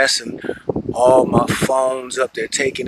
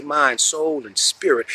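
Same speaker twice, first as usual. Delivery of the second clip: ordinary delivery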